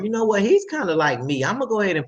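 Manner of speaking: growing confidence. Retold or imitated. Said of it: "that's giving them the confidence to be like".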